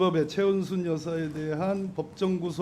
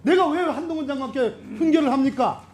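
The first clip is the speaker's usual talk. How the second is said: high-pitched, forceful protest; heated indignation; 10 semitones higher, 6 dB louder than usual. Real, not acted. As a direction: reject the accusation with raised pitch. Angrily denies lecturing the minister.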